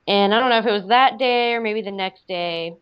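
usual speaking voice

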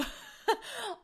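short high laugh; brief high-pitched laugh at yourself; delighted laugh. Real, not acted. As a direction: burst into a quick high giggle. Laughs after gushing over crystals.

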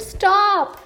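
sharp high call to stop; hollering. Acted. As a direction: holler it out in alarm. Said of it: "Moose hollered".

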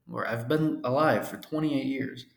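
ordinary delivery

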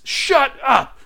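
high, loud squeals of disbelief; imitating her overjoyed shut up; retold excited shriek. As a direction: squeal it like she can't believe it. Girlfriend reacts to proposal.

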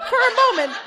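real laughter, high, loud; loud, high giggle through the words; laughing. Real, not acted. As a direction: break up laughing mid-line. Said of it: "I'm not proud of that one".

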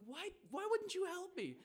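high, loud, drawn-out plea of disbelief; exasperated appeal. Retold or imitated. Tourist to the farmer who did not help, loud exasperated appeal. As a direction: demand an explanation, voice way up. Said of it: "I give this shrug of".

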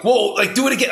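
high, loud, amazed; like a kid after a magic trick; excited. Retold or imitated. The speaker begging the priest to repeat the trick, excited and high. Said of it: "I was like".

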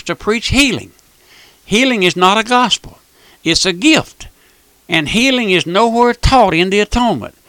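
fervent radio preacher, driving; revival-style preaching; preaching at full force. Acted. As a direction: build the sermon with rising fire.

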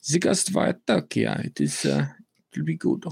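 put-on German accent. Podcaster naming a game for co-hosts, mock German accent. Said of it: "put a German accent on there".

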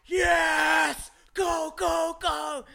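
very high, loud re-enacted scream; excited screaming. Passenger re-enacts screaming through a hot lap, thrilled shouting. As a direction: whoop and urge the driver on at full pitch. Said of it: "I was screaming, man".

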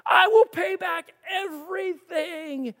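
tearful loud plea, slow; begging. A narrator's reading. Kneeling servant begging master, tearful plea. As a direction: cry out a desperate promise. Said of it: "he begged".

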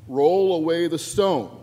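slow, loud command; loud, commanding quote. Acted. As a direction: call it out loudly, drawing out each word. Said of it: "And he says loudly".

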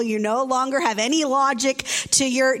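normal talking voice

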